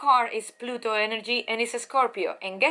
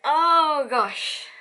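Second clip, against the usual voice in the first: a sudden, surprised gasp of delight, higher and louder; surprised oh gosh; 4 semitones higher, 5 dB louder than usual. Real, not acted. Reader surprised by the card she drew, exclaiming in delight. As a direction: caught off guard by the card, exclaim it. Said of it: "Why I'm laughing?".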